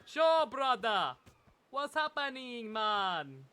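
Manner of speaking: mock Jamaican island slang, unhurried; Jamaican accent. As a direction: lay on a laid-back Jamaican accent, stretching sure brother. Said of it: "can you do a Jamaican accent".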